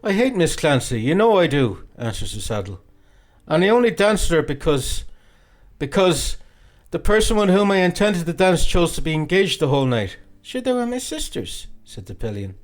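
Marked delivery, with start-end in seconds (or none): 0.0-1.8 a hint of a hurt lad, raised and louder; sulky boy protesting. A narrator's reading. Young Redmond protests. 3.5-10.2 a hint of a hurt lad, raised and louder; sulky boy protesting. A narrator's reading. Young Redmond explains, stammering. 10.4-11.7 put-on lightly raised, teasing girl's voice; coquettish young woman. A narrator's reading. Nora laughing in superiority.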